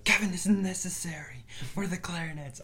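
whispered mock teacher line. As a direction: breathe it out as a snide aside. Teacher voiced mockingly.

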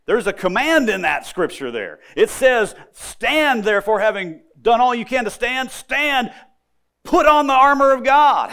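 loud, high, driving sermon build; preaching at full force. Acted. Preacher urges the congregation to stand firm, fervent. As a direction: rise to a pulpit climax on the armor.

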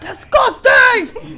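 slow, high, laughing yell; disgusted shout. Shown as a demonstration. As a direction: belt it out in mock disgust. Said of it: "Yeah, there you go".

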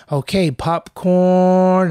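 high, loud, slow sing-song call; teacher drawing out popcorn. Retold or imitated. Teacher scanning the room before calling a student, drawn-out tease. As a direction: stretch the word out like a teasing teacher. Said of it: "they'd be like".

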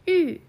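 "う" as Mandarin ü, loud, high then falling; fourth-tone ü. Shown as a demonstration. Teacher isolates the dialogue's syllable, "う" as falling fourth-tone ü. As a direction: start "う" high and drop it firmly, lips rounded. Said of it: "この発音で四声で発音".

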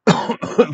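harsh real cough; coughing fit. Real, not acted. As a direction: cough hard mid-sentence. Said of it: "I just keep coughing".

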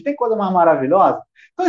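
normal talking voice

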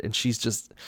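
ordinary delivery

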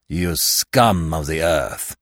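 low, slow growl. A narrator's reading. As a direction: growl it with contempt. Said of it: "growled Ganimard".

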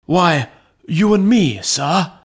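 high, loud, ready answer; undaunted. A narrator's reading. Butler volunteering to break in with the lawyer, undaunted. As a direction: answer boldly, without hesitation. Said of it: "was the undaunted reply".

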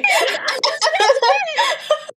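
high burst of laughter; shrieking laugh. Real, not acted. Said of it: "No way!".

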